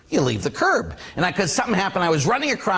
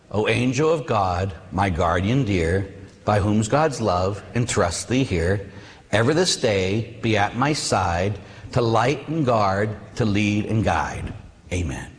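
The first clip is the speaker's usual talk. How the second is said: low, slow, measured prayer recitation; recited prayer; 6 semitones lower, slower than usual. Acted. Preacher recalls the old guardian angel prayer, reciting slowly. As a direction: recite it evenly, line by line, low and unhurried.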